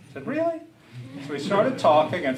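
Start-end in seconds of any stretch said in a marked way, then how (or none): 0.3-0.6 a sharp high disbelieving question; incredulous really. Retold or imitated. Son questioning his old father, incredulous high rise. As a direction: doubt him openly, pitch leaping up.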